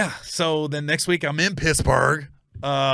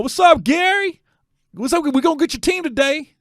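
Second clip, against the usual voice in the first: teasing fans; 12 semitones higher, 7 dB louder than usual. Retold or imitated. Dallas fans teasing the comic in his seat, high and loud. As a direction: hail him like a rival fan. Fans greeting him at a game.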